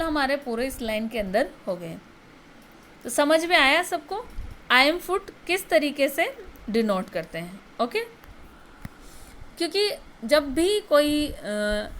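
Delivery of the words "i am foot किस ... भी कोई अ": ordinary delivery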